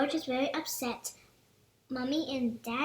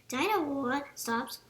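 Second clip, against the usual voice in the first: usual speaking voice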